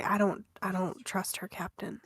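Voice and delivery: ordinary talk, nothing marked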